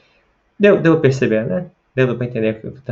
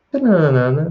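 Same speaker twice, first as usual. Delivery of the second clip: "Tananana" hummed out as a falling question tune, starting high; question melody demo; 4 semitones higher, 4 dB louder than usual. Shown as a demonstration. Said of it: "você vai perceber que é uma melodia diferente". ("Tananana" com a melodia da pergunta, começando agudo)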